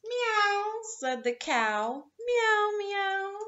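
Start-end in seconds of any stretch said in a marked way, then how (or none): 0.0-0.8 high meow from the cow. Acted. 2.2-3.4 high meow from the cow. Acted.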